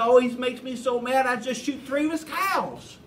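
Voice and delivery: high, worked-up mock anger; hammed-up angry punchline; indignant friend. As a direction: imitate a friend proudly echoing the rage. Friend angrily explains shooting cows.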